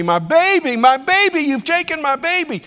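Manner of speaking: put-on high, desperate cry for her baby; frantic, pleading mother's wail. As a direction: wail it as a panicked mother running. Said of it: "began to run and began to say".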